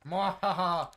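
loud maniacal villain cackle; mad scientist laugh. Acted. Gloating villain laugh in game.

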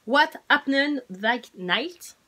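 slow, careful English with a French accent. Shown as a demonstration. French vlogger reads an English book title, slow English accent. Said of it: "pour mon accent ag-, anglais".

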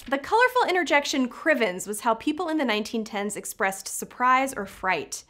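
ordinary talk, nothing marked

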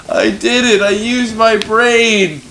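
loud high victory shout; triumphant yell. Real, not acted. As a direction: scream your win to the room. Triumph after solving puzzle.

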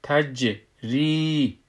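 تجری slowly, bounced j and long ī; madd and qalqalah. Shown as a demonstration. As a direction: bounce the j in تجری, then hold the ī.

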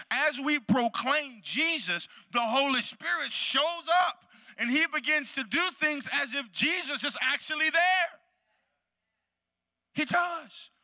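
high, slow, building preacher's proclamation; full-force preaching. Real, not acted. Pastor to his church on the Holy Spirit, preaching full force.

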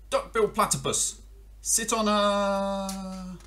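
lightly voiced frog, high and drawn out; a frog calling out, a touch high. Frog calls to the platypus, lightly voiced.

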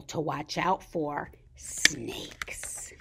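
hushed and cautious; whispered warning. A narrator's reading. Narrator warning the children about snakes, whispered. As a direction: whisper it like the snake might hear.

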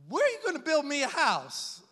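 hammed-up Italian God demanding where the house is; playful Italian-accented voice of God. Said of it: "what God is saying with an Italian accent".

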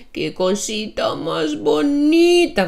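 put-on high, slow cooing baby talk; kindergarten teacher. Shown as a demonstration. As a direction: coo it like talking to a kitten. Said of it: "мы говорим так, сюсюкаясь". (сюсюкающий голос)

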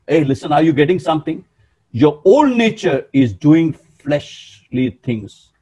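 ordinary talk, nothing marked

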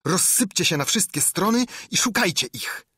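high-pitched, forceful command; insistent repeated orders. A narrator's reading. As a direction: send the gang searching in every direction. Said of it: "powtarzał Piu, waląc kosturem o ziemię".

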